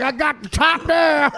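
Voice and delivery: high, loud, slow comic holler; Cedric the Entertainer. Retold or imitated. Retelling a stand-up's bit to co-hosts, comedian imitation. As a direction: stretch it out loud and high, laughing. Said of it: "Cedric the Entertainer does a bit".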